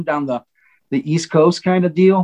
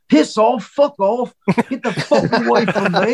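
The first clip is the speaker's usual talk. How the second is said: rude brush-off; 7 semitones higher than usual. Retold or imitated. Retold rocker rudely telling fans to get away, mock angry. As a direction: fake a rock star telling fans to get lost. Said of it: "he would be so rude and tell people".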